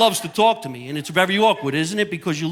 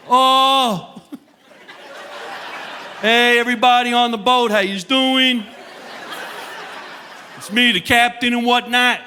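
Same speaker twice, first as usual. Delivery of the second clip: comedian as captain; 8 semitones higher than usual. Pretend. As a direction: announce it like a tipsy captain on the PA. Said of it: "if I came over the loudspeaker".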